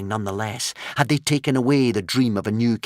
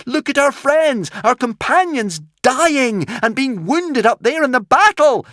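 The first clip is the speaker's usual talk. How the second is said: high, loud desperate scream; 6 dB louder than usual. A narrator's reading. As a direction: scream about dying friends. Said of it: "screamed Hiccup".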